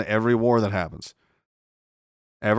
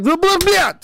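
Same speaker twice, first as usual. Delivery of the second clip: mock Putin; 7 dB louder than usual. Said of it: "He'll be like".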